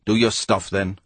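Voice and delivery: ordinary delivery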